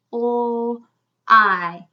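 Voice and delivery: O, I as separate drawn-out sounds. Shown as a demonstration. Teacher counting the sounds in a syllable, separately. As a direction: hold each sound alone with a gap. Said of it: "Let's count the sounds".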